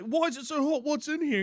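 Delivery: high, puzzled mock outcry; imitating the baffled flatmate. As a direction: ask it like a man whose mouth is burning.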